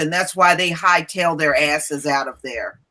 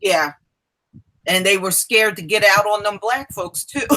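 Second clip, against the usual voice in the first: ordinary talk, nothing marked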